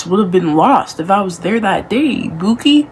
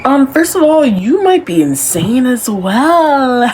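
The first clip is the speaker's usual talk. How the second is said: shocked; 7 semitones higher, 5 dB louder than usual. Real, not acted. Shocked reaction.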